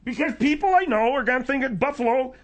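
high, tearful explanation; upset, high-pitched protest; crying. Acted. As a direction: plead it as if starting to cry. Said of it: "Why are you crying?".

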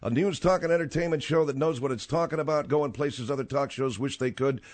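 high, ringing show intro; radio announcer. Real, not acted. Host reads the show introduction.

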